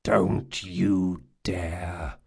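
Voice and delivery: low, very slow menacing growl. A narrator's reading. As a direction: growl it slowly as a warning. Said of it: "growled Jean".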